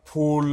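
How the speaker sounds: teacher punches ফুল with a clear ফ; aspirated f sound. Shown as a demonstration. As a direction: demo of ফুল with Bengali ফ. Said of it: "এফ এর উচ্চারণটা ফয়ের".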